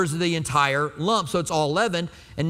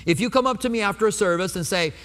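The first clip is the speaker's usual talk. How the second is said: ordinary talk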